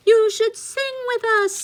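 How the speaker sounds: very high, loud birdie voice; birds. Acted. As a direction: chirp it like little birds. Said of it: "said the birds".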